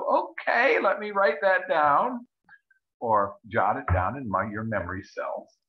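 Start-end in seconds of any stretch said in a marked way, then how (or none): 0.0-2.3 mock eager okay. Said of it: "And you go".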